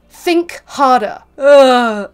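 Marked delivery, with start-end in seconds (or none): 1.4-2.1 loud "ugh" of real anger; exasperated groan. Real, not acted. Getting mad at the mansplainers, a loud exasperated groan. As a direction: groan loudly, fed up. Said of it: "I'm so sorry I'm getting mad".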